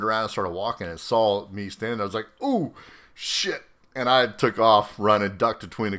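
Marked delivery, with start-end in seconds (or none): 2.4-3.6 a sharp, high startled yelp; caught off guard. Retold or imitated. Commander turns and sees him, high startled yelp. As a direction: jump up in pitch as if caught.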